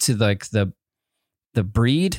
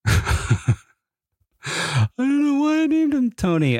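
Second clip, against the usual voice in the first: laughing it out, high and slow; 12 semitones higher, much slower than usual. Real, not acted. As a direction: laugh through the line at yourself. Laughing at own childhood story.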